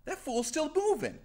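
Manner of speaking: high, shocked outcry; alarmed exclaim. Retold or imitated. Killers noticing Rasputin still moving, high alarmed. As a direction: squeak it in alarm. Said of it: "He's like".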